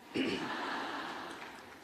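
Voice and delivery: soft emotional throat clear. Real, not acted. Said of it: "we'll blame allergy instead of emotion".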